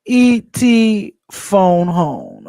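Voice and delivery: slow, drawn-out E.T. croak. Retold or imitated. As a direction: do E.T. phoning home. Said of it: "I'm just like".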